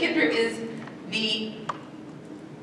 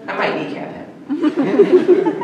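laughing mock threat; 6 semitones higher, 6 dB louder, much slower than usual. Real, not acted. Joking threat while laughing.

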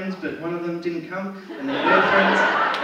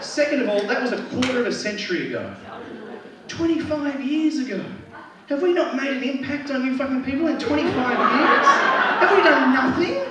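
indignant comic rant, climbing high and louder; hammed-up indignation, rising as it goes; mock outrage; 8 semitones higher, 3 dB louder than usual. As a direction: build to an exasperated, high-pitched protest. Acted indignant retort, building.

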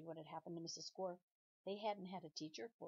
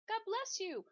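much higher, louder, sweet schoolgirl chirp; bright polite blessing; 13 semitones higher, 6 dB louder than usual. Acted. Nancy blesses Paul's sneeze, high and sweet. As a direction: pipe it up like an eager pupil.

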